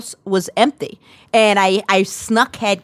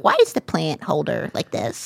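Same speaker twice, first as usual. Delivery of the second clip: quiet annoyed mom; 5 semitones lower, 7 dB quieter than usual. Retold or imitated. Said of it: "then she was like".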